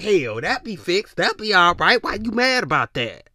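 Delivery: a hint of a worked-up complainer; mock angry person.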